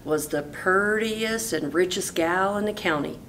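ordinary delivery

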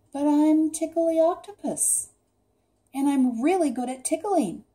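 high-pitched, mildly sad plea; sad protest. A narrator's reading. Rejected Octopus defending his tickling, mildly sad. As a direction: plead a little sadly, voice lifted. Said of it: "said Octopus sadly".

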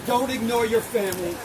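usual speaking voice

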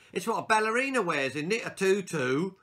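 high, fast and loud schoolboy joke; bratty boy's quick quip; cheeky boy. Acted. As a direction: show off to the class.

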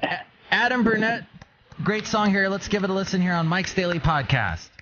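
radio announcer voice. Pretend. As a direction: introduce the track like a radio host. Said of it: "my radio announcer voice".

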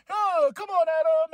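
hammed-up begging at a friend; mock plea. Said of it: "He's like".

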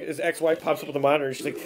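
ordinary talk, nothing marked

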